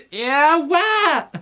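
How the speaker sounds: very high squealed words through laughter; hysterical laughing. Real, not acted. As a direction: dissolve into helpless high-pitched laughter. Losing it laughing over the book.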